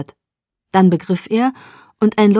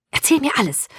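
higher-pitched, urgent demand; eager demand; 7 semitones higher than usual. A narrator's reading. Curious friend pouncing before she sits down, eagerly demanding. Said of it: "verlangte sie".

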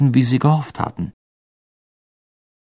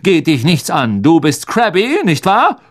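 higher, officious questioning; stiff; 6 semitones higher, 3 dB louder than usual. A narrator's reading. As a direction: put him in his place stiffly. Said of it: "sagte er steif".

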